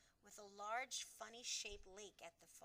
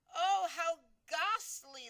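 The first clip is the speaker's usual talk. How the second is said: higher, much louder, slow anguished cry; 8 semitones higher, 15 dB louder, slower than usual. A narrator's reading. Queen crying out at the news, anguished. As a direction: cry it out in horror. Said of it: "the famous voice cried out".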